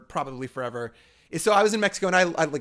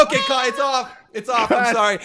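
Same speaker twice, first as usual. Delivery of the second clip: laughing apology; 8 semitones higher, 5 dB louder than usual. Retold or imitated. Apologizing to crying kid, laughing.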